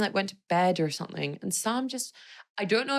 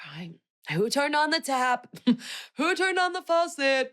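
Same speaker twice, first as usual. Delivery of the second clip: high-pitched, laughing mock outcry; mock exclamation; 9 semitones higher than usual. Joking about sudden tears at a speech, high mock outcry. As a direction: exclaim it higher on the second question.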